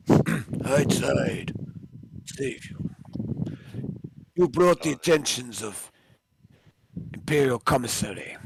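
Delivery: put-on very slow, heavy Russian accent. GM switches the lieutenant to a Russian accent. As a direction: drag the line out in a mock Russian accent. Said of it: "Now we're going Russian".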